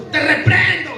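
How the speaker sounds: high, loud mock shout at a demon; hammed-up loud rebuke. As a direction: yell it like a showy exorcist, half laughing. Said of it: "comenzar a gritarle a un demonio".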